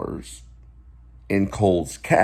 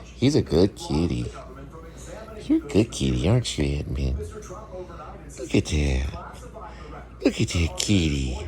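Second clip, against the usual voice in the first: soothing a pet; 6 semitones lower, 5 dB quieter than usual. Real, not acted. Talking softly to the cat.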